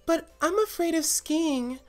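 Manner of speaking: very high, loud cartoon voice; timid high yarn voice; yarn character voice. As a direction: squeak out a nervous little confession. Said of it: "Now I'm gonna do yarn".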